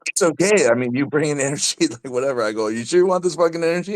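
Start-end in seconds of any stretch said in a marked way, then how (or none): 0.1-2.4 pitched well up, imitating the host's easygoing reply; high mock quote. Retelling the host's reassuring reply. 2.7-4.0 high and fast, mock challenge; hyped retold warning. Retelling his own warning.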